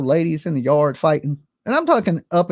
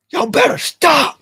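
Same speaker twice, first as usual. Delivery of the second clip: stern command; 11 semitones higher, 4 dB louder, slower than usual. Retold or imitated. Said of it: "I was like".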